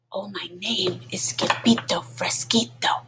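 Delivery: whispered, barely voiced; very soft voice. A narrator's reading. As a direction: breathe the words softly. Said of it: "sing in a muy, muy soft voice".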